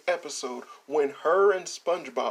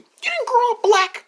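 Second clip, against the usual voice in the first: loud, high-pitched disbelief; incredulous outburst; 8 dB louder than usual. Real, not acted. Incredulous mockery of the list.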